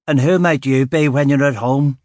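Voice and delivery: a hint of East Belfast grit, challenging; East Belfast accent. East Belfast woman confronting the detective, mild East Belfast accent. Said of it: "with an East Belfast accent that sounded like broken glass".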